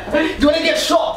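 high, laughing fake menace; laughing mock threat. Rapper to chef, a laughing mock threat. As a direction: threaten him through a laugh.

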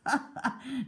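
brief amused chuckle; short laugh. Acted. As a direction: let out a quick laugh. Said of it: "Baldhead laughed".

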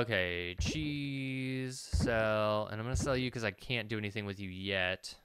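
usual speaking voice

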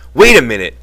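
short, loud shouted check. A narrator's reading. George grabs Slim to stop him, shouting. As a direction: shout it to hold someone back. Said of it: "he shouted".

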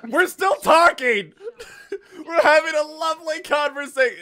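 laughing shout of protest. Real, not acted. Streamer laughing back at the game. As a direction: blurt it out through a laugh.

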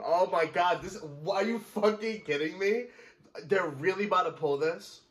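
high, loud, incredulous; can't believe they'd do this; outraged disbelief. Real, not acted. Outraged at plot twist.

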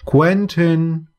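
a called name from out of the fog; eerie voice calling through fog; deep voice calling. A narrator's reading. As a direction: call his name out of the mist. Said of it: "called a deep voice through the fog".

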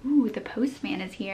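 ordinary delivery

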